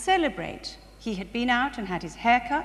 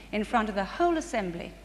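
ordinary talk

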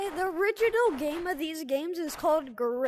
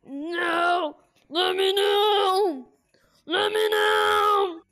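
loud, slow desperate yell; panicked shout; 2 semitones higher, 10 dB louder, much slower than usual. Real, not acted. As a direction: cry out in panic. Panicking in game.

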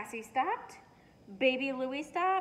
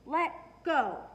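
loud, higher tug-of-war shout; 5 semitones higher, 7 dB louder than usual. A narrator's reading. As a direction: bark the command at the dog, louder. Daddy tugging the tree.

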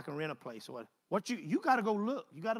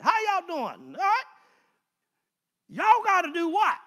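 loud, high, driving sermon voice; preaching at full force; 8 semitones higher, 10 dB louder than usual. Acted. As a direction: build the congregation up, shout it out.

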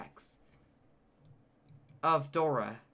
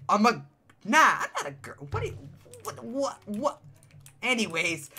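ordinary talk

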